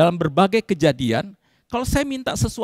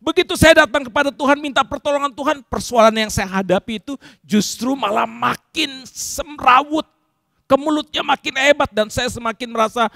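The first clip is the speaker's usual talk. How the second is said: normal talking voice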